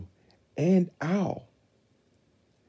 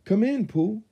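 Christopher Robin voice; 7 dB louder than usual. A narrator's reading. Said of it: "smiling as he led".

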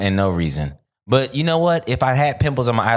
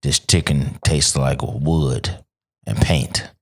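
much lower and drawn out; deep slow voice; 10 semitones lower, much slower than usual. Shown as a demonstration. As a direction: drop the voice deep and slow it right down. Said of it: "Say that one more time".

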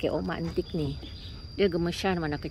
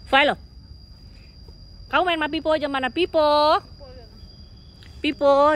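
higher, louder coaxing voice; pet talk; 8 semitones higher, 4 dB louder than usual. Real, not acted. Owner coaxes her cat to go play, higher pet voice.

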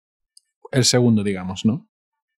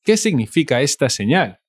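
quick, run together; fast everyday delivery; natural speed; much faster than usual. Shown as a demonstration. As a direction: say it fast, like a native speaker. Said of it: "teacher repeats at natural speed".

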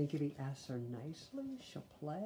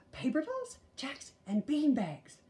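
much higher, smug sister; sister listing; 11 semitones higher, 4 dB louder than usual. A narrator's reading. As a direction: count them off sweetly.

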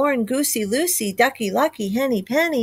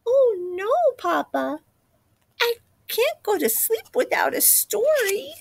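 put-on child voice; 9 semitones higher than usual. Chick pleading.

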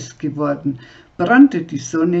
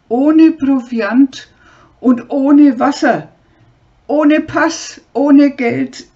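desperate screaming; 6 semitones higher, 6 dB louder than usual. Acted. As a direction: scream every loss after him. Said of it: "schrie sie ihm hinterher".

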